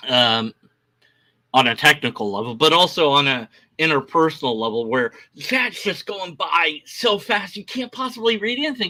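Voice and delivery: normal talking voice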